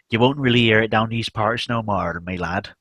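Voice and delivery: put-on old-fashioned rural Devon accent. Shown as a demonstration. Streamer showing viewers a rare regional accent, broad Devon accent. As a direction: put on a broad West Country English accent. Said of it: "someone talking like this".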